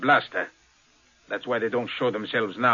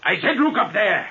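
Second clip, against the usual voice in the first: sharper, higher repeat of the command; insistent order; 6 semitones higher than usual. Acted. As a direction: snap the order again, pointing up.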